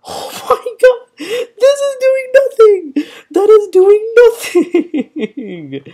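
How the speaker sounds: very high, loud panicked wail; squealing, last word stretched out; freaking out. Real, not acted. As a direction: shriek in helpless disbelief. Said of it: "Dude, I'm freaking out".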